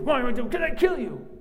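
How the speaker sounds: high, loud furious yell; furious Bilam. Acted. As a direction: shout a threat at the donkey. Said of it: "The donkey opens its mouth and says".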